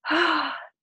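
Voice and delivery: high, loud moved sigh; emotional sigh. Real, not acted. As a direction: let out an overwhelmed sigh. Emotional sigh before thanks.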